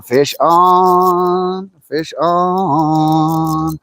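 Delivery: long, stretched fish-on call; drawn-out call. Real, not acted. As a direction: draw the last word right out, celebrating the catch. Calling out a hooked fish.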